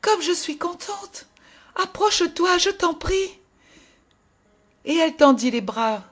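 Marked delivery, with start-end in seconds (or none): 0.0-3.4 put-on higher little girl's voice, glad and eager; a small child overjoyed to see her grandmother; joyful little girl. A narrator's reading. Little girl begging her grandmother to come closer, glad and eager. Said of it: "s'écria la petite".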